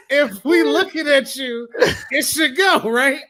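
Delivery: high, loud, slow through laughter; laughing through words. Real, not acted. Co-host joking about Cyclops's aim, laughing through words. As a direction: crack up while making the joke. Cracking up over Cyclops joke.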